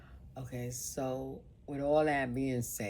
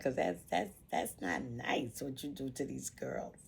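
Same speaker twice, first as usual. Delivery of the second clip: retold scolding; 5 semitones higher than usual. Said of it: "I'd be like".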